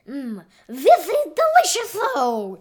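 high, loud put-on Italian accent; best Italian accent. A narrator's reading. Taco tasting the cheese sauce, showy Italian accent. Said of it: "in his best Italian accent".

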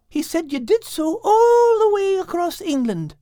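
a high voice urging her friend to trust; Kate the cat. Acted. Kate encourages Al.

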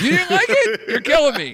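high, loud, incredulous; can't believe what you just heard; shocked disbelief. Real, not acted. Friend disliked a favorite movie.

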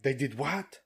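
put-on light French accent, slowed on the middle line; lightly done French voice; mock French accent. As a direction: toss it off as a quick French caricature. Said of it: "my micro impression of a French person".

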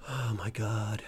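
low, hushed exasperation; weary dismay. Real, not acted. Ranting host despairs at the public, weary dismay. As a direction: sigh it out low and soft. Exasperated aside in a political rant.

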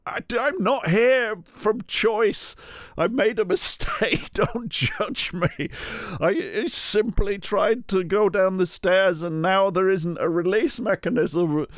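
high, slow pleading put-on voice; odd put-on voice. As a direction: strange pompous plea, pitched high. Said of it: "I don't know what voice I'm doing".

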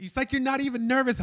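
high, loud mock accusation; imitated frantic outburst; letting it all out. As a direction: yell it in an exaggerated high voice. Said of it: "let it all out for a second".

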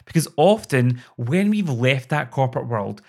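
usual speaking voice

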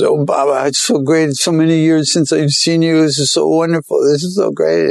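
high, gushing joyful greeting; overjoyed sadhu. Retold or imitated. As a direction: voice him bursting with delight at meeting. Sadhu overjoyed greeting old friend.

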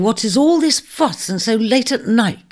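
high, loud fussy grumble; grumbling complaint. Acted. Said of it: "complained Virgo, roused from sleep".

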